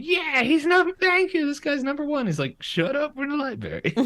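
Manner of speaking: squealing way up high in a mock scream; imitating an overexcited sister shrieking, then her brother's high hush. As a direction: squeal like a thrilled kid. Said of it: "starts screaming".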